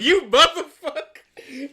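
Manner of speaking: drawn-out, loud, high curse, laughing all through; mock cursing through laughter. Imitating the speaker getting played off, laughing loud and slow. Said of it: "He was like".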